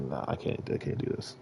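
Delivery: near-silent retold breakdown; broken whisper. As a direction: barely get the words out. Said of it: "I was like".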